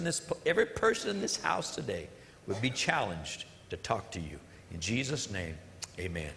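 soft, earnest opening prayer; hushed pastoral prayer. Real, not acted. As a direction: pray it quietly and sincerely.

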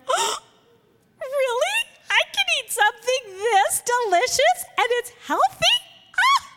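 high, squealing delight at a healthy cookie; mock excited gasp. Listener gushing over a healthy cookie, high and excited. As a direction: gush like it's too good to be true. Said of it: "We'd be like".

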